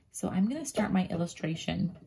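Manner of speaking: normal talking voice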